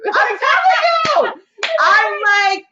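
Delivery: high, loud, drawn-out exclaim; slow loud exclamation. Real, not acted. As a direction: shout it in exasperated agreement. Reacting to host's teasing remark.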